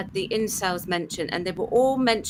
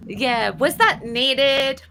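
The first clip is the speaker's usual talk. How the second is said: bad American accent. Mocking a TV character in a bad American accent. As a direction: drag it out in a badly faked American Valley Girl voice. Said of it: "that was a really bad American accent".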